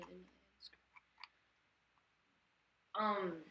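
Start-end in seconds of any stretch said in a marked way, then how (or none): 0.0-0.8 whispered surprised aside; whispering. Real, not acted. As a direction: breathe it out in a whisper to yourself. Surprised aside while browsing list.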